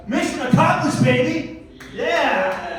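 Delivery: high, crowing celebration; triumphant. Acted. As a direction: cheer your own success, ending on a long yeah. Triumphant after getting son out.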